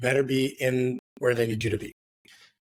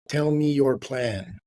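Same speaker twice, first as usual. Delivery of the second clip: slow, word-by-word order; slow it right down, firm; slow, firm demand; much slower than usual. Retold or imitated. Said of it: "He goes".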